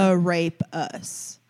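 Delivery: "arepas" very slowly, no Spanish accent; slow accentless arepas. Shown as a demonstration. Showing how aggressive the word sounds, slow drawn-out "arepas". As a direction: stretch "arepas" out in American English. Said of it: "when you say it slower".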